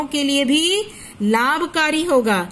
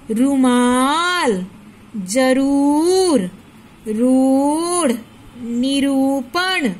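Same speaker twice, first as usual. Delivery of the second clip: slow word reading; 2 semitones lower, much slower than usual. Shown as a demonstration. Teacher to pupils reading the word list, slow and drawn out. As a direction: read each word very slowly for the class to hear. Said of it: "तो क्या पढ़ेंगे हम?".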